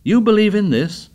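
can't believe what he just heard; amazed. A narrator's reading. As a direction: leader stunned by a friend's words. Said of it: "Ralph stood up in amazement".

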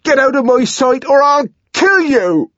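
loud, high raging threat; furious, threatening bellow; menacing shout. A narrator's reading. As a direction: roar a threat over someone fallen. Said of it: "standing over her menacingly".

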